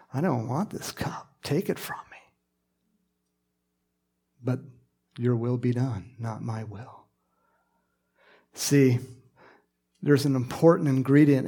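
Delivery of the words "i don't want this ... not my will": soft, fading unvoiced plea; hushed garden prayer; whispered prayer. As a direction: pray it in a hush, surrendering. Jesus praying in the garden.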